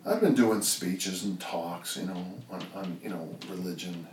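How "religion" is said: ordinary talk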